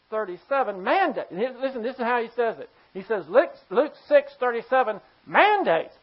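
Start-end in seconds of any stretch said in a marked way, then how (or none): none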